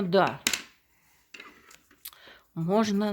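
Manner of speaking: ordinary talk, nothing marked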